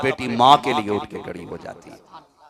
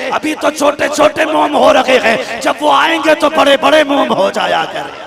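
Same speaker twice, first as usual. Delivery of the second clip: higher and louder, building to a cry; rising sermon climax; 9 semitones higher, 8 dB louder than usual. Acted. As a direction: build the preaching to a crescendo. Preacher climaxes again. (ऊँचे सुर में चढ़ता हुआ जोशीला बयान)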